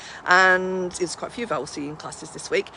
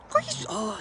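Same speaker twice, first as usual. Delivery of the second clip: surprised; 5 dB quieter than usual. Real, not acted. Reacting to dog's bark.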